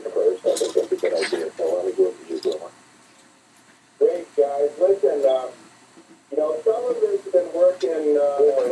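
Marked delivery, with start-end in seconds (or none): 4.0-8.7 echoing tunnel. Real, not acted. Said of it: "sound like you're in the tunnel".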